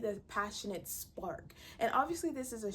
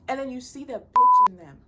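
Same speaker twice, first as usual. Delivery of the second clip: emphatic burst; 4 semitones higher, 17 dB louder than usual. Real, not acted. Ranting about alpha men.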